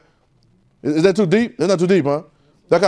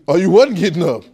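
usual speaking voice

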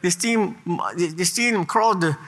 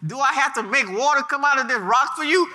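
Moses vexed; 7 semitones higher than usual. Acted. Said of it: "Moses said".

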